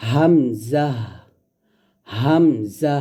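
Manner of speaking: হামজা light from the top of the throat; Arabic letter demo. Shown as a demonstration. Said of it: "গলার শুরু থেকে হামজা". (গলার শুরু থেকে হামজা)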